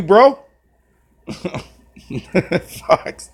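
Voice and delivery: ordinary talk, nothing marked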